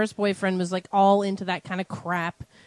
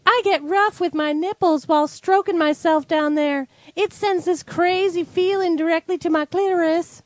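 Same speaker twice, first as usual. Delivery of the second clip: high, performed testimonial reading; woman's voice; 7 semitones higher than usual. Acted. Co-host reads Stephanie's magazine confession, high woman's voice. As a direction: read it in a higher put-on woman's voice. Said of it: "I want you to read in a woman's voice".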